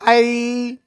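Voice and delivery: आई held long on a level note; prolonged pluta vowel. Shown as a demonstration. Teacher lists pluta vowels, आई drawn out. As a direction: stretch out the glide in आई. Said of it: "प्लुत होते हैं गुरुजी".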